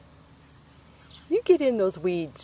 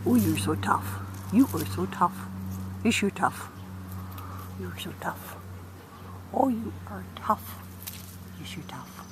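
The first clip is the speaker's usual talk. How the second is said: soft teasing coo; 3 semitones lower, 8 dB quieter than usual. Real, not acted. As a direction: lower and much softer, gently teasing. Cooing at a feisty chick.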